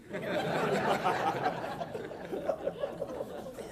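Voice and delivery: soft voiceless chuckle; breathy laugh. Real, not acted. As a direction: laugh at your own joke. Joke about household.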